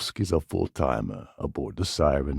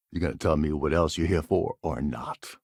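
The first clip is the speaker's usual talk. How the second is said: normal talking voice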